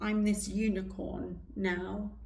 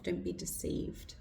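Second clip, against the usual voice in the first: soft breathy whisper; 5 dB quieter than usual. Real, not acted. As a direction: whisper it like a secret warning. Opening line, spoken unvoiced.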